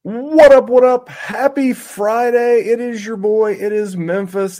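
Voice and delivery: high, loud hype opener; hyped podcast greeting. Real, not acted. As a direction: fire up the listeners from the first word. Host's hyped show opener.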